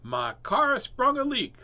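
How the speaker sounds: high, slow, loud rabbit cry; drawn-out, high-pitched rabbit exclamation; storybook rabbit. A narrator's reading. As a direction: wail it as a rabbit with car trouble. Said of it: "said Rabbit".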